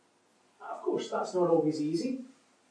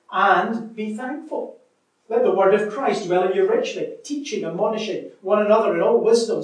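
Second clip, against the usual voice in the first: raised, emphatic Bible reading; scripture reading; 4 semitones higher, 7 dB louder than usual. Acted. Preacher reading scripture to church, emphatic. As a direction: proclaim the verses to the congregation. Preacher reads Colossians aloud.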